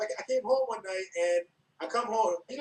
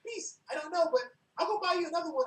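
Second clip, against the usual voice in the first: put-on high, whimpering scared drunk voice; imitating a frightened drunk roommate; scared drunk friend; 10 semitones higher than usual. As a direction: plead nervously, tearful. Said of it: "and Mondo goes".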